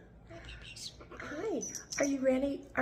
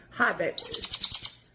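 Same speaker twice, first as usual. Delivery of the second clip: low, slow, breathy hi babies; deep voice; 6 semitones lower, 7 dB louder, slower than usual. Real, not acted. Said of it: "I like it when you do your deep voice".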